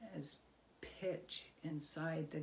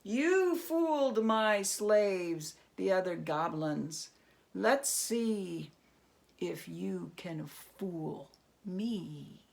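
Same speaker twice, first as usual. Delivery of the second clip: loud, slow monster voice that drops to a hiss; booming goblin king; 6 dB louder, slower than usual. Acted. Goblin king warning Herschel he cannot fool him.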